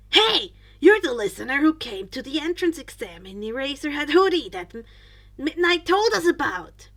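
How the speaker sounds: loud, eager teacher calling out; teacher loudly recognizing the boy from the entrance exam; excited yell. Acted. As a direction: shout it across the class with glee. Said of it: "Mike yelled".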